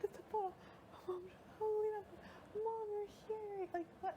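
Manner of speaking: high-pitched, overwhelmed re-enactment; imitating overwhelmed disbelief; retold tearful shock. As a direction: relive seeing Mom, voice way up high. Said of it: "started crying".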